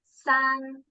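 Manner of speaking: "San" said high and level as Mandarin first tone. Shown as a demonstration. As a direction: keep "San" high and flat. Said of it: "the high and flat pitch".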